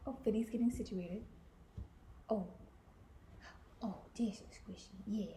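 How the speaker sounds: soft unvoiced whisper. Real, not acted. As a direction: whisper so the dog sleeps on. Whispering so dog sleeps.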